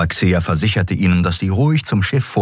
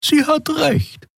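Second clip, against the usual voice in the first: a higher, gentle female character voice; Xea's voice. A narrator's reading. Xea urging Keru to join, lifted voice. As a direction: coax him kindly in a lifted voice. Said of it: "erinnerte Xea".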